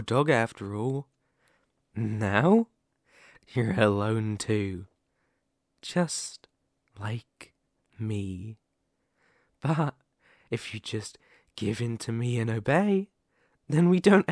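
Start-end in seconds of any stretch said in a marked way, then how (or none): 5.8-8.6 tender confiding. Acted. Likens captive's loneliness to their own.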